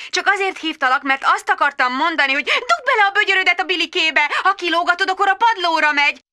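high hurried mum voice; frazzled mum. Acted. Magda on the phone coaxing her toddler, high and fast. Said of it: "Magda voice".

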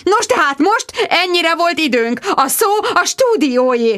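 high, sing-out presenter sign-off; trilling. A narrator's reading. Bridget hands back to the studio, trilling. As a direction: chirp it over the chaos. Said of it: "trilláztam".

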